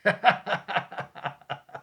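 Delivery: high rippling laugh. Real, not acted. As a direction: laugh in a long, high run. Laughs after being teased.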